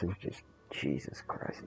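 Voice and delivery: whispered, drained disbelief; hushed "Jesus Christ" of pure exasperation; exasperated whisper. Real, not acted. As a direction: breathe it out in weary disbelief. Exasperated aside after kicking player.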